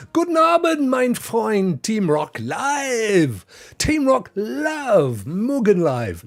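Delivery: high, drawn-out mock German greeting; German accent. Streamer greeting a German viewer by chat, playful German accent. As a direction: call it out in an exuberant German accent. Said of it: "I'm loving the Germanic".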